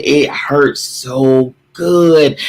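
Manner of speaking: drawn out and slow, leaning into good; a preacher relishing the ache; slow, savoring. Real, not acted. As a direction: let it stretch, savor every word. Preacher savoring painful growth.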